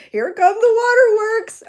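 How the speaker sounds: high, loud, drawn-out wail; playfully weepy. Real, not acted. Teased host warning viewers of tears, playfully weepy. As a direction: wail it, half joking about the tears. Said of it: "she's gonna cry all willy-nilly".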